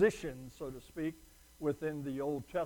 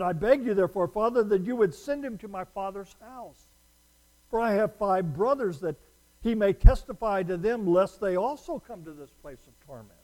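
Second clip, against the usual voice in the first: rich man pleading; 4 semitones higher, 8 dB louder than usual. Acted. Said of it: "Then he said".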